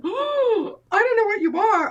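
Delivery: high, loud gush over a puppy; smitten cooing. Retold or imitated. Cooing at newly found puppy.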